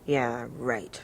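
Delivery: a low, soft murmur; barely audible. Retold or imitated. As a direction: mumble it at your hands. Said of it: "he had a barely audible voice".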